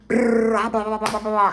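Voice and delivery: loud, high "brrr, blah-blah" babble; wordless angry ranting. Retold or imitated. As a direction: rattle off nonsense as a mock tirade. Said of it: "I don't even got no word".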